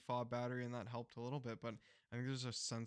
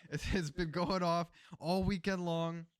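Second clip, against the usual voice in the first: higher, louder retold complaint; pissed neighbor; 5 semitones higher, 5 dB louder than usual. Neighbor complaining about the car alarm, mock pissed. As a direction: imitate a fed-up neighbor, dragging out the grievance. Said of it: "he was pissed".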